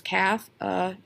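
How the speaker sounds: "Cafeteria" split into five separate beats; syllable by syllable. Shown as a demonstration. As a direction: count out each syllable of "Cafeteria" with gaps. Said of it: "look at how many syllables".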